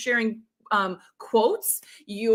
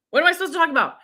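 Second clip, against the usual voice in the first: higher, rushed exasperated wondering; frustrated; 4 semitones higher, much faster than usual. Acted. Viewer who knows they should be live, frustrated. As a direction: fret aloud in a quick, raised voice. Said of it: "maybe you're even frustrated".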